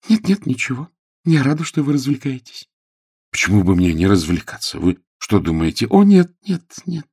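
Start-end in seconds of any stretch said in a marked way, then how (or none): 0.1-2.7 put-on higher young lady's voice; piqued Jane. Jane hears he still plays golf, piqued. 5.9-7.1 put-on high, loud young lady's exclamation; protesting Jane. Jane answers Frederick's challenge, protesting.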